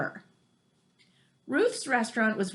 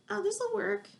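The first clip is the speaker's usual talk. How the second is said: higher, carefree "this'll work"; breezy hurried self; 10 semitones higher, 4 dB quieter than usual. Retold or imitated. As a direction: shrug it off, sure it will work. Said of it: "I'm all about".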